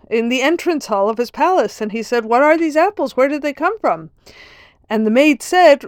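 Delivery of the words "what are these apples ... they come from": surprised. Retold or imitated. Nobleman finds the apples.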